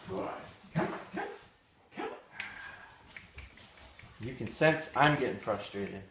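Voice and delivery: ordinary delivery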